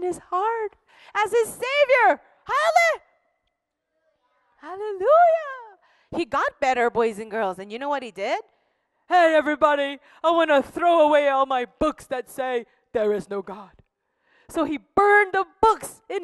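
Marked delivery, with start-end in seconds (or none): 2.4-5.8 excited hallelujah. A narrator's reading. Storyteller cheering as the man is saved. 9.1-13.8 mock proclamation. Converted man calling to everyone.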